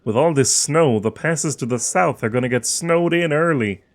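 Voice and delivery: put-on voice of a long-time local; local townsman. Townsman complaining to a visitor about the weather, put-on voice. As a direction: village local grumbling in a character voice.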